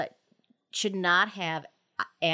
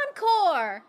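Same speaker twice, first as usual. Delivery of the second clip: loud, high call for more; shouted cheer; 8 semitones higher, 6 dB louder than usual. Acted. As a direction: cheer from the crowd. Audience calling for encore.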